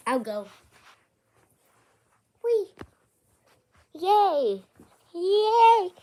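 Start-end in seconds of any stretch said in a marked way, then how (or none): 2.4-5.9 each yay higher and louder than the last; silly cheers rising into a squeaky high pitch; voice climbing higher. Pretend. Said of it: "Why is your voice changing?".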